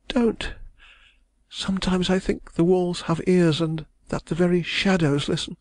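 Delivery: high woman's voice, fearful and hushed; frightened young woman. A narrator's reading. Young woman warning the lawyer, fearful and high. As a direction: warn him as if the walls listen.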